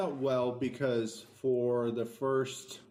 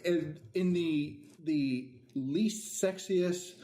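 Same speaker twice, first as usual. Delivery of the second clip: slow, careful; 3 semitones higher, much slower than usual. Real, not acted. Guest slowly describes the clinical podcast, drawn-out words. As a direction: draw each word out carefully.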